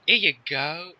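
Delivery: put-on regional twang; regional accent. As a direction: imitate an uncertain regional British accent. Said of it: "regional accents coming from [name] here".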